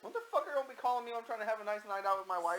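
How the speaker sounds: high-pitched, put-on annoyance; irritated mock rant. Host imagines Nick answering the call, mock irritated. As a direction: play a man griping at a prank caller.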